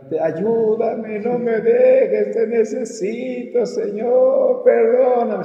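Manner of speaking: high, very slow wailing cry to God; grito de súplica imitado. Retold or imitated. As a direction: re-enact a loud, desperate plea, stretching every word. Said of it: "empecé a escuchar que gritaban". (súplica a gritos, alargada y aguda)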